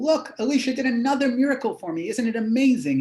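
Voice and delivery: a thrilled woman showing off her miracle; excited woman. Acted. Woman telling the whole town, excited and high.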